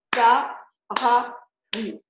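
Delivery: very slow, each syllable pulled apart; slow teacher's syllable count. Shown as a demonstration. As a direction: stretch parapluie out as pa-ra-pluie, one beat per syllable. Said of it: "il va mettre un trait pour chaque syllabe".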